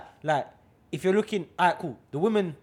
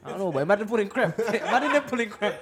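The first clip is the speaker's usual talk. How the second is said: laughing; 5 semitones higher, 4 dB louder than usual. Real, not acted. Laughing at the image.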